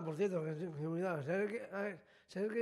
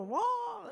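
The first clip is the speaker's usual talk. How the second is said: very high, sudden "wooh!"; imitated scream; 13 semitones higher than usual. Teen acting out the tied-up man seeing them, high scream. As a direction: mimic a scare actor's shriek. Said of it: "Empezó a chillar".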